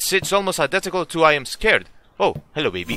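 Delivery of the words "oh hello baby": ordinary talk, nothing marked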